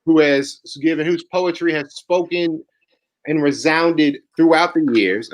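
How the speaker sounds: slow, stately announcer build-up; grand slow intro; formal introduction. Real, not acted. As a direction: draw out each phrase for the guest. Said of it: "formal build-up to speaker introduction".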